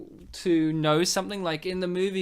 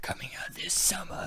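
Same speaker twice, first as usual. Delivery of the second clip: whispered horror-trailer voiceover; 8 dB quieter than usual. Pretend. Narrating his imagined horror-movie edit, soft whispered trailer voice. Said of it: "I was gonna be like".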